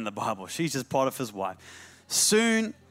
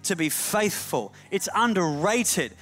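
building to high, ringing sermon delivery; fervent pulpit climax; impassioned preaching; 9 semitones higher, 3 dB louder than usual. Real, not acted. Preaches at rising force.

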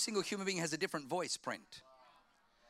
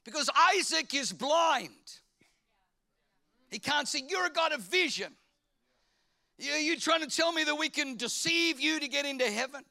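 a touch of pleading with God; 8 semitones higher, 3 dB louder than usual. Retold or imitated. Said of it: "I'm like".

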